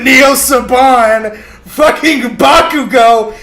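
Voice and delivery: loud, slow high screech; loud slow screeching mock voice. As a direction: screech it out like a shrieking teen. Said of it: "like, screeching".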